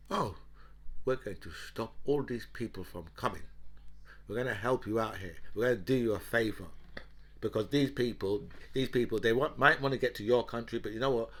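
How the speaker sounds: low, quiet, sarcastic mock assurance; imitating the French. The French pretending to do the English a favour, mock helpfulness. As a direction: play the other side in a hushed, low undertone. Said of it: "the French would be like".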